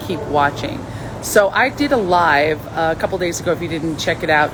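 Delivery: ordinary talk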